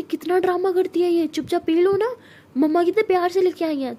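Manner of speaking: put-on girl scolds her dramatic cousin, high and exasperated; exasperated scolding. A narrator's reading. Jess scolds Sara.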